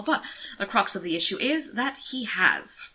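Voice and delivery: plain reading voice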